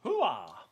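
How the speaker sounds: gung-ho army hoo-ah; loud gung-ho soldier. Acted. Soldier cry.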